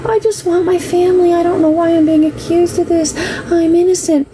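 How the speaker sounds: high, whiny mock innocence; mock pleading, self-pitying ex-husband; mimicking him. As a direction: play the wounded innocent ex. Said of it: "He would".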